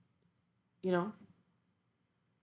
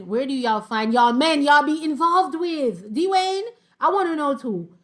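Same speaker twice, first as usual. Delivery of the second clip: high, loud, slow drawn-out disbelief; incredulous; 7 semitones higher, 9 dB louder, slower than usual. Real, not acted. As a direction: stretch it out in amazement. Incredulous at commenter's question.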